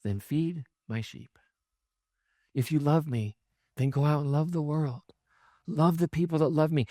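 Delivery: calm the frustrated friend; gentle answer. Acted. Jesus answering Peter gently.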